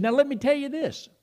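plötzlich hoch und aufgeregt, wie ein begeisterter Anrufer; excited caller. Retold or imitated. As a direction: den Satz des Freundes hoch und freudig aufgeregt nachmachen. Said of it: "And he was all excited".

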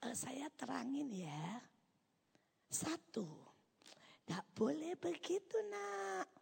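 soft, slow coaxing, pitch climbing; indulgent explainer voice. Retold or imitated. God imagined coddling a child, soft and singsong. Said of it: "yang berkata".

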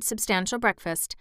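usual speaking voice

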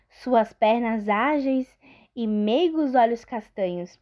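usual speaking voice

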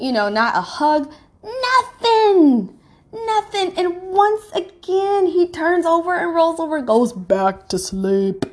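high, tearful voice cracking on nothing; crying. Real, not acted. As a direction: fight back tears as you tell it. Crying while recounting his indifference.

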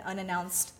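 ordinary talk, nothing marked

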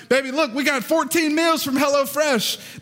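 high, slow, drawn-out excited call; excited announcement to wife. Retold or imitated. Husband bursting in to tell wife, high excited call. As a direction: sing it out to your wife like big news. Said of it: "I'm like".

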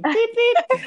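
high squeaky beep beep; horn imitation. Mimicking the little car's horn, squeaky and high. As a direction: honk like a tiny car horn.

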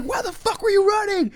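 high, strained angry demand; yelling cop. Retold or imitated. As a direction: interrogate as an agitated officer. Police demanding answer from kids.

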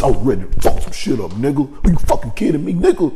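real furious shouting rant. Real, not acted. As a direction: vent real rage at the absent parents. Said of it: "that shit had me so goddamn pissed".

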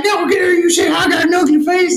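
put-on high shrieking shout of a furious old woman; mock angry old lady. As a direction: yell it like an outraged granny with a cane. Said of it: "And she's like".

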